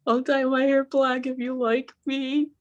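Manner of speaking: high, drawn-out begging; mock pleading. Newcomer begging the woman who snubbed her, mock pleading. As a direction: beg to be liked in a put-on high voice.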